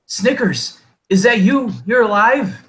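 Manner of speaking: high-pitched kobold crying out in surprise; kobold calling out. Acted. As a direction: call out high and loud to a lost comrade. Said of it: "they are basically calling out".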